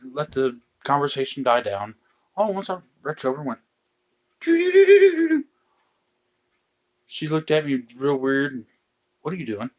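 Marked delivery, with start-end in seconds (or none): 4.4-5.4 rapid, very high, loud doo-doo trill; high staccato falsetto sound effect; silly poking noise. Retold or imitated. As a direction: burst into a piercing toy-like doodling noise. Imitating noise while poking wife.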